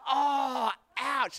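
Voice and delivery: high, hammed-up hurt reaction; mock wounded. Runner hearing the wife call them hopeless, mock wounded. Acts out reaction to wife's insult.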